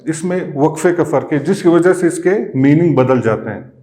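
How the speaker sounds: usual speaking voice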